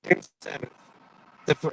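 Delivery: staticky phone line; words breaking up through static; crackly cordless phone. Real, not acted. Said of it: "you're still staticky".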